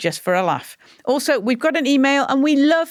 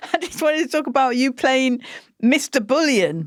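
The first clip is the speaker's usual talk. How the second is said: ordinary talk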